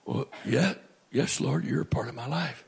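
low, soft, humbled reply; chastened admission. Retold or imitated. Chastened answer to God, retold.

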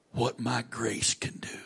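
hushed whisper of God's words; voicing God in a soft whisper. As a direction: let the last words fall to a whisper.

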